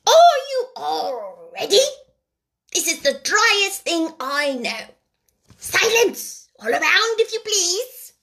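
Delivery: high, pompous little mouse voice; important mouse. A narrator's reading. The mouse lectures the wet animals, high and pompous. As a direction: puff up like a tiny lecturer. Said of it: "with an important air".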